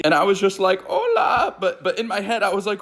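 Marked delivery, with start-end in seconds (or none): 0.9-1.6 very high, uneasy greeting; strained high-pitched hello back; awkward high hola. Retold or imitated. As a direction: squeak out a baffled hello. Baffled reply to changed friend.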